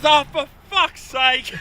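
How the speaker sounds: slow, loud, high mock exasperation; retold exasperated outburst. Owner hearing the sore stomach excuse, imitated exasperated shout. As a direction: groan it out loudly, fed up.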